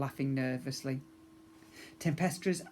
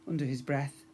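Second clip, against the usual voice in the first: ordinary delivery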